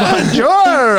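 high French Canadian caricature; mock French Canadian. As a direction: greet in a hammed-up French Canadian accent. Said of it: "they're like".

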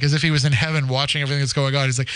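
normal talking voice

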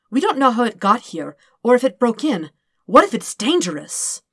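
worried. Acted. Dirk worried about the baby.

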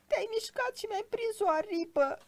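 very high, pained squeal; shrieking tiny creature. A narrator's reading. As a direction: squeal as if your wing is pinned. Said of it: "țipătul făpturii aceleia nevăzute". (țipăt pițigăiat de greieraș rănit)

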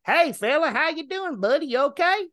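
high-pitched put-on neighborly hail; nosy neighbor voice. Neighbor hails driver from his yard, high mock voice. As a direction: call out high as a prying neighbor. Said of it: "there's a neighbor".

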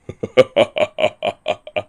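falling, wheezy laugh; defiant laugh. A narrator's reading. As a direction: laugh off the pain. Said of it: "coughed up some blood and laughed".

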